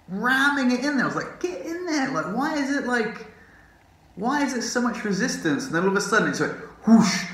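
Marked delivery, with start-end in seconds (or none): 0.1-5.7 high-pitched retold exasperation; straining frustration. Retelling a painful struggle. 6.9-7.2 loud, sudden whoosh; imitated whoosh.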